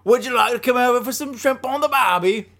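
high, loud, hammed-up Aussie; mock Australian. Host joins the accent game, loud mock Australian. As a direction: belt it out as a cartoon Australian. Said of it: "How about Australian?".

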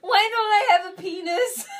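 high, very loud belted question; hammed-up musical-theatre cry; mock anguished outburst. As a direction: burst out with it theatrically. Quoting the show, sudden outburst.

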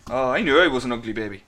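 ordinary delivery

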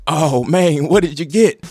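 high, loud, thrilled nephew; excited kid. Acted. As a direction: squeal with excitement over a gift. Excited nephew gets gift.

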